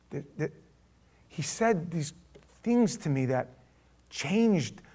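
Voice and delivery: very soft, stumbling explanation; hushed, halting. Acted. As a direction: grope softly for words, deeply moved. Officer haltingly explains himself.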